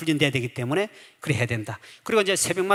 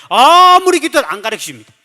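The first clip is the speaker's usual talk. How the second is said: usual speaking voice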